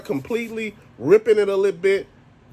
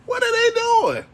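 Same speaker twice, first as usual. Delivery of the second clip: much higher imitated wife, exasperated; imitating his wife; 3 dB louder, slower than usual. Wife asking what the group is doing, much higher exasperated imitation. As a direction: pitch it way up like his wife in disbelief. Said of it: "She said".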